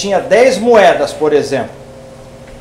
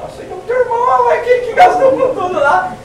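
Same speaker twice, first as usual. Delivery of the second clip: high, laughing gossip about the brother; gossiping servant; 13 semitones higher than usual. Retold or imitated. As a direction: spill the news with delight, pitched up.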